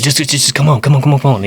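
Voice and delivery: high, loud imitated urgent stammer; freaked-out stutter. Panicked uncle urging his nephew to come, stuttering. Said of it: "he, like, stutters like that, like he's freaked out".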